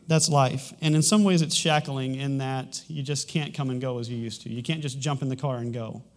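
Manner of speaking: ordinary talk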